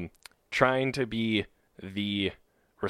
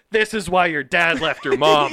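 mock road rage insult; 7 semitones higher, 10 dB louder than usual. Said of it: "you're like".

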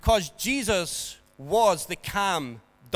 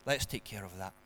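much softer and lower, unhurried reassurance; calm doctor; 10 semitones lower, 11 dB quieter, faster than usual. Acted. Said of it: "and very calmly say".

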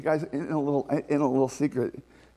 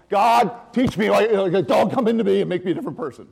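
raised, pleading cry to God, quick and loud; fervent pleading prayer; 5 semitones higher, 7 dB louder than usual. Retold or imitated. Preacher voicing the psalmist's prayer, fervent and loud. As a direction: beg God earnestly, rushing. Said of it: "which is what?".

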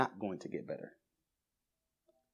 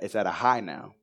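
ordinary talk

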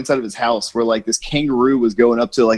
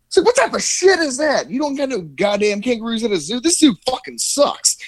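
higher-pitched hammed-up fury at the zoo; mock outraged rant; 10 semitones higher than usual. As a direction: play the furious customer, pitched way up. Irate visitor ranting at zookeeper.

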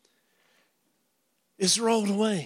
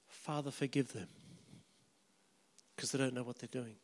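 soft dying words; 9 semitones lower, 18 dB quieter, faster than usual. Acted. Jesus's words from the cross.

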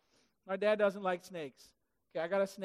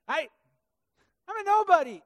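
a higher, louder Moses, pleading that he's a nobody; whiny protest; 8 semitones higher, 9 dB louder than usual. Acted. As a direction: beg off like a reluctant hero.

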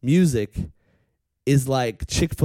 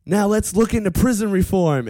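high-pitched mock rally speech; rallying announcement; 7 semitones higher, 3 dB louder than usual. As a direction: keep up the rally call. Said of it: "And then he's going".

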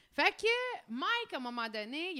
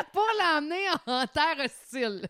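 laughing; 6 semitones higher, 5 dB louder than usual. Real, not acted. Joking about Montreal outsider.

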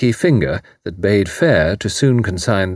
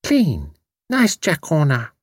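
put-on high, smiling little girl's voice; beaming toddler; 7 semitones higher, slower than usual. A narrator's reading. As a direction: announce it proudly like a small child. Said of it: "announcing with a beaming smile".

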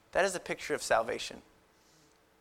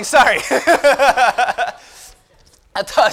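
usual speaking voice

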